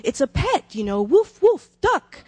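high, loud mock Italian accent with a laugh and woof barks; Italian man explaining, with barks. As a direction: play the Italian guest barking woof to mean pet. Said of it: "They said".

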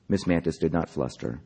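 ordinary delivery, nothing marked

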